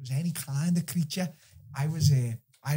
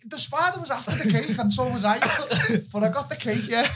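laughing; 10 semitones higher, 4 dB louder than usual. Real, not acted. Laughing about spider and cake.

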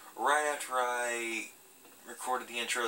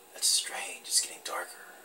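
hushed, uneasy whisper; spooked whisper; 6 semitones lower than usual. Real, not acted. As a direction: breathe it out as the room goes dark. Spooked by the darkening scene.

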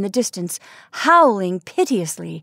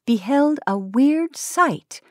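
ordinary delivery

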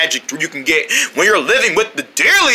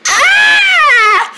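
very high, loud shriek; demonstrated scream; 6 dB louder than usual. Shown as a demonstration. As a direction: show off a piercingly high scream. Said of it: "This is my scream".

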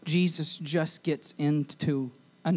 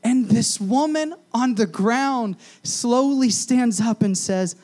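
full-force preaching; 9 semitones higher, 7 dB louder than usual. Real, not acted. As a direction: drive the point home to the room.

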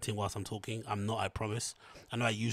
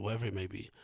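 usual speaking voice

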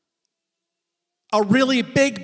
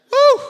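exultant whoop; 13 semitones higher, 7 dB louder than usual. Real, not acted. Preacher's exultant cry.

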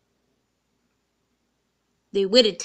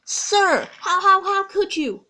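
much higher, louder, stammering disbelief; shocked; 10 semitones higher, 4 dB louder, slower than usual. Acted. Toby seeing Percy pull his coaches, high stammering shock. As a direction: blurt it out, stunned and hurt. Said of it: "He was shocked".

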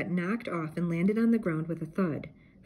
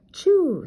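loud, high dog sneeze; 11 semitones higher, 9 dB louder than usual. A narrator's reading. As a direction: explode into the sneeze. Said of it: "Scooby sneezed".

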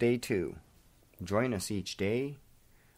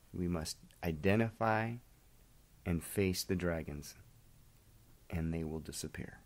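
hushed, reverent closing prayer; quiet earnest prayer; 2 semitones lower, 5 dB quieter than usual. Acted. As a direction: speak it softly to God, slowing down. Continues the prayer.

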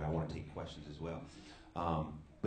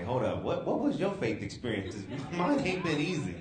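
laughing mock challenge; 6 semitones higher, 5 dB louder than usual. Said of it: "Y'all might just wanna, you know, say".

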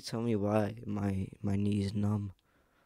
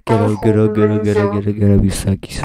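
yelling get up at full volume; shouting; 20 dB louder than usual. Real, not acted. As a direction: scream it into his mic. Shouting to wake someone.

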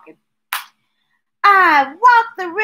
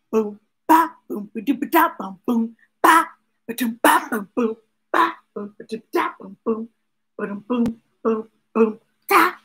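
drum sounds; 6 semitones lower, faster than usual. A narrator's reading. Drum beat vocalized in story.

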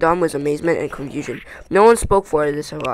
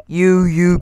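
louder, sputtered outcry; crying out; 6 dB louder than usual. A narrator's reading. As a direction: cry it out at the culprit. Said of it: "cried Thorin".

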